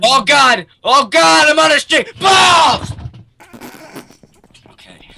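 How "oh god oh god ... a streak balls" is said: excited shout. Real, not acted. Excited then fails jump.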